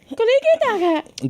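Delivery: high slow mock voice; high put-on voice. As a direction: stretch it in a silly voice.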